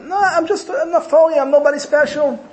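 humble disciple brushing off praise in a high voice; humble Naftali. Retold or imitated. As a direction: shrink yourself, high and self-effacing. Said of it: "So he said".

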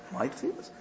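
breathy disbelieving hush; stunned whisper. Acted. The chassid, amazed that the Rebbe praises his prayers, whispers in disbelief. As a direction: barely voice the question in shock.

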